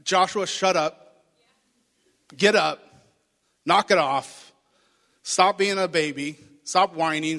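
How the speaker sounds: loud, impatient scolding; stern rebuke. Retold or imitated. God scolding a whining Joshua, loud and stern. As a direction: tell him off like a fed-up parent. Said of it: "in my words, is like".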